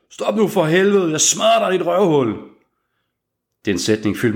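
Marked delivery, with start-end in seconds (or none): 0.1-2.3 furious threat. Acted. Gang thug yells at the fleeing man: a furious shout. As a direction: scream it at him like you'll tear him apart.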